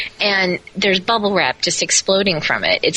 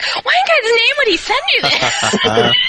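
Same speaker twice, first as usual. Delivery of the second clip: much higher, slower, stunned friend voice; incredulous friend. Retold or imitated. The bodybuilder friend on the phone, high and incredulous. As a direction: play the gasping, disbelieving friend. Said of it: "and she's like".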